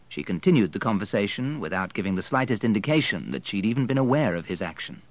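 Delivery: usual speaking voice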